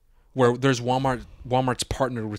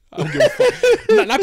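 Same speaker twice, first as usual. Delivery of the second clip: laughing; 10 dB louder than usual. Retold or imitated. Laughing at his own story.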